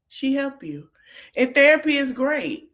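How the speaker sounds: ordinary talk, nothing marked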